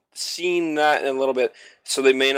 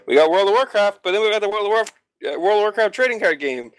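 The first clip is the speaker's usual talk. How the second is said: mock sales pitch; 7 semitones higher than usual. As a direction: sell it like a smug game publisher. Mocking the game companies' sales pitch.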